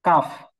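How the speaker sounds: কাফ said thin, tongue a little forward; light front ক in কাফ; thin Kaf. Shown as a demonstration. Teacher demonstrating thin Kaf to students. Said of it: "জিহ্বার গোড়া থেকে একটু আগে বাড়িয়ে".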